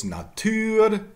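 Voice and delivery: "Natur" as na-TÜR; high "Natur" with French u; French-style pronunciation. Shown as a demonstration. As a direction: stress the end of "Natur". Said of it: "more examples".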